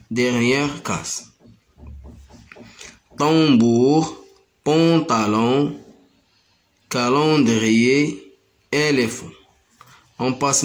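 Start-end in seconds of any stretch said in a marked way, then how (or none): none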